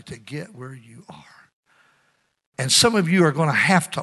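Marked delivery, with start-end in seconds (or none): 0.0-1.5 breathy whisper, barely voiced. Preacher whispering to the congregation in a hush.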